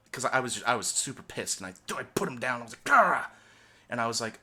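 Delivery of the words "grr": loud, raised growl of frustration; short burst of mock rage; imitated angry growl. Retelling putting the turtle down super pissed, imitated growl.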